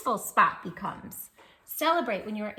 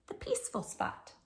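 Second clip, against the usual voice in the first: whisper; 13 semitones higher, 7 dB quieter than usual. Acted. Soothing a child through calming.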